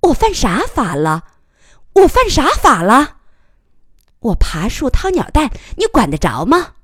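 high indignant challenge, building to loud; aggressive, defiant. A narrator's reading. Cornered reporter challenging the officer, aggressively defiant. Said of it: "气势汹汹地说".

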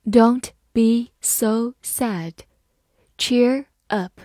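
slow, careful "Don't be so sad. Cheer up."; teacher reading slowly. Shown as a demonstration. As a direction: read it slowly for the class. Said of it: "好，慢慢来读".

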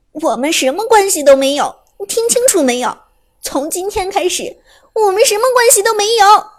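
tearful fury rising to a shout; through gritted teeth. Acted. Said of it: "咬牙切齿地说".

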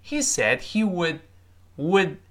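slow "would" with the final d held; careful model, "would" cut off on a silent d; unreleased d. Shown as a demonstration. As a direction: stop the d in "said" and "would" without releasing. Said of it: "依然是失去爆破".

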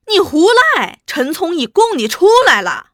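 high, loud outburst; angry accusation. Acted. Interrogator confronts prisoner with informer's confession, loud and angry. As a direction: shout down the denial.

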